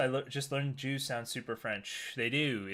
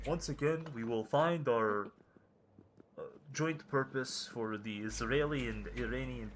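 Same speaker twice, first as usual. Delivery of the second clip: halting, Russian-sounding Israeli accent; shaky attempt at an Israeli accent, sliding into Russian; 2 semitones lower than usual. Shown as a demonstration. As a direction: fumble through a bad Israeli accent. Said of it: "Do an Israeli accent?".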